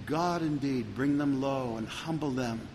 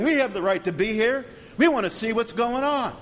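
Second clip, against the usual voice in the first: raised, higher defiant crowd voice; defiant shout; 7 semitones higher, 3 dB louder than usual. Retold or imitated. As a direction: throw it back at the police, high and loud. Protesting youths shout at police.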